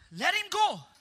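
high, loud crowd demand; crowd crying out. Retold or imitated. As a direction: shout it out like the mob. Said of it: "the crowd cried out".

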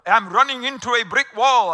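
mock Englishman abroad, loud in a vague foreign accent; loud foreign-sounding accent. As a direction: put on a clumsy foreign accent and say it loudly, as if to someone who doesn't understand. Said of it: "spoke louder with an accent".

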